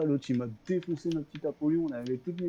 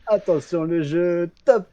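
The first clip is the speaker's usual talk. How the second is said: game show host; 4 semitones higher, 10 dB louder, slower than usual. Acted. Mock game-show announcement.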